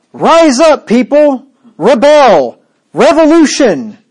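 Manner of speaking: loud, high mock rally shout; mock rallying cry. Mock rebel call, not Paul.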